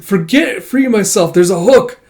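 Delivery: high, loud, strained urgency; urgent distress. Acted. Kippa is caught on a hook, urgently distressed. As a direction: call out as if trapped. Trapped character calls out in battle.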